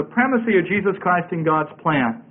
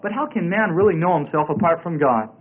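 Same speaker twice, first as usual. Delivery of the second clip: ordinary delivery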